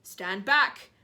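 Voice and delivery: loud shout; loud warning shout. Acted. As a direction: bark the warning. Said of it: "Aunt Sponge shouted".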